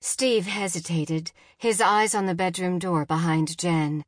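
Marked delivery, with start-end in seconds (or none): none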